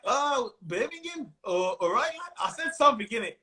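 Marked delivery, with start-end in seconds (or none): 0.1-2.1 attempted Birmingham accent, drawn out slowly. Comic trying Birmingham accent on a local crowd. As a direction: have a go at a mock Birmingham accent, starting high. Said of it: "I tried to do a Birmingham accent".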